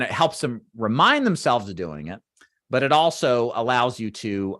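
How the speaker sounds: normal talking voice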